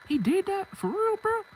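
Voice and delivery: high-pitched incredulous question; acted disbelief. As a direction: imitate a friend who can't believe it. Friend reacts to the complaint.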